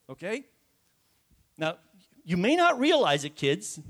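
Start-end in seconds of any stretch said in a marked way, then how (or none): none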